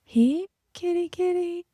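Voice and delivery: high, soft sing-song "here, kitty, kitty"; light, high kitty call; cat call. Shown as a demonstration. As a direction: coax a cat gently and high. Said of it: "You do this little thing where you go".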